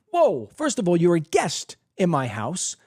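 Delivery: usual speaking voice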